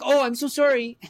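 high, loud, startled Canadian apology; apologetic stranger. Retold or imitated. Stranger rammed by friend's wheelchair, startled high apology. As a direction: yelp out a sorry like someone just rammed by a wheelchair. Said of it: "they'd turn around and be like".

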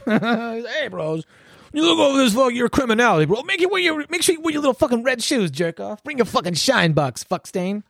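high-pitched, jeering put-on voice; mocking taunt. Trump making fun of red shoes, mocking taunt. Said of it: "he's making fun of this motherfucker's red shoes".

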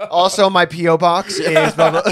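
mock self-promoter. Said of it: "people like, plugging themselves".

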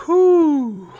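loud high exhale of relief; relieved whew. Retold or imitated. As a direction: blow it out, glad it's over. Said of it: "we're just like".

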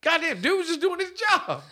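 high, squeaky mock indignation; mock outrage. Friend mocks the punched guard's complaint, pitched high. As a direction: whine it like the wronged guard.

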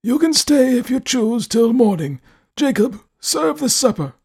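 put-on imperious elderly recluse, demanding; stern old man. A narrator's reading. Host grants stay, stern.

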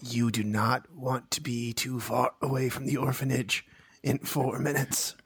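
ominous warning. Acted. Warning about the orphanage deadline.

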